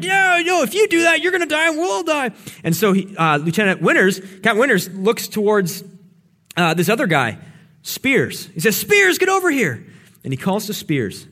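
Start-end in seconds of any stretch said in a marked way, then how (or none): 0.0-2.3 much higher, louder urgent warning; alarmed warning. Retold or imitated. General warning the major against charging, high alarmed voice. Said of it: "the general's like". 8.8-9.9 much higher calling-out voice. Retold or imitated. Captain calling Spears over in battle, high call.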